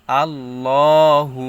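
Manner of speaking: loud "আল্লাহু" with long rounded law; heavy lam. Shown as a demonstration. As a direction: hold the dark second syllable of "আল্লাহু". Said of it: "ল উচ্চারণ হবে". ("আল্লাহু"-তে মোটা লামে লম্বা টান)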